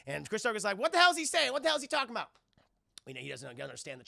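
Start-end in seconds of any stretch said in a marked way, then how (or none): 0.8-2.3 high, loud exasperated question; baffled demand. Retold or imitated. Carter not understanding the Chinese, exasperated demand. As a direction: demand a translation, hammed-up and impatient.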